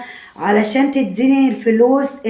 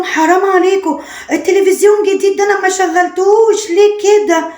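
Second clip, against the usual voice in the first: raised, higher pleading complaint; upset protest; 6 semitones higher than usual. Retold or imitated. Bride protesting to her in-laws, raised and high. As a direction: cry out in dismay at the damage.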